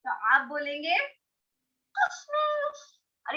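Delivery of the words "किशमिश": "किशमिश" squeaked up high; a tiny mouse-like "किशमिश"; high squeaky voice. Shown as a demonstration. Teacher demos the raisin word like a scared mouse, high. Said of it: "किशमिश में कैसे झुर्रियां होती है ना".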